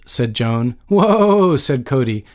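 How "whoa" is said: drawn-out, high, placating whoa; smooth it over with a raised "whoa"; easygoing peacemaker's whoa; conciliatory. A narrator's reading. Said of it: "with a conciliatory laugh".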